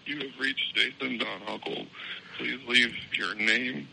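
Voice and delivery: usual speaking voice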